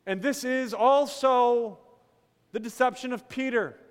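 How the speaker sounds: loud, emphatic sermon voice; full pulpit voice; preaching at full force. Acted. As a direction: press the point from the pulpit.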